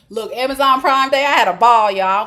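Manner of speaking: big, loud enthusiasm; excited gushing. Real, not acted. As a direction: burst out bragging to friends about a great haul. Gushing about a shopping spree.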